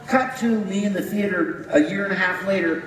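usual speaking voice

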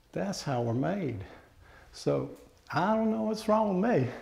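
put-on unhurried country-accent storyteller, pausing between phrases. Reciting a poem to an audience, simple country accent. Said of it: "I do it with a country accent".

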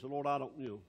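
low, subdued doubting mumble; half-hearted, low-pitched admission of doubt; voice of unbelief. Acted. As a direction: doubt it softly, quickly, low in the voice. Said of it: "That's what unbelief is".

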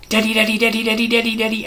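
put-on high excited little boy calling daddy; pestering child. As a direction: call out like an eager toddler. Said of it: "he just went".

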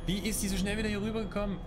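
high, fast startled outburst; startled disbelief. Real, not acted. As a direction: blurt it out in shock. Game enemy suddenly appears again.

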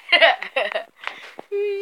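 light high giggle; giggling laugh. Real, not acted. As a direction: burst into a giggle. Laughing at the fake yawn.

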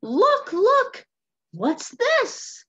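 high, loud acted excitement; astonished townsfolk. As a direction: call out like gawking townsfolk. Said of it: "The townsfolk say".

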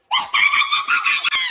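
high-pitched hysterical yell; panicked scream. Acted. Brother announces sister's secret, panicked scream. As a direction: blurt it out in a hammed-up shriek.

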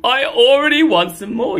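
high greedy cat line. Acted.

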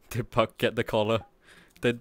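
ordinary talk

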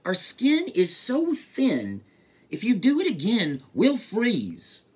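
lightly voiced, high fretful fish; high storybook complaint; fish character. A narrator's reading. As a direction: plead high as a chilly fish. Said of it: "said the fish".

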